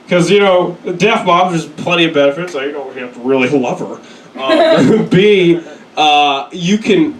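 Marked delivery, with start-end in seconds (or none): none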